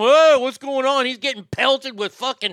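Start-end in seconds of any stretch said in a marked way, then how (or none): 0.0-1.0 higher, louder startled yokel cry; bewildered Bubba. Retold or imitated. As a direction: play a confused neighbor caught in the hail. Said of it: "Fucking Bubba's in the backyard going".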